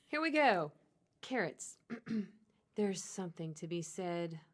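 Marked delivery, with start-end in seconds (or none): 1.2-4.4 seduce the listener with a carrot bag; sexy voice. Pretend. Reads the carrot bag to her co-host as a sexy hotline voice. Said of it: "I'm gonna read it in my sexy voice".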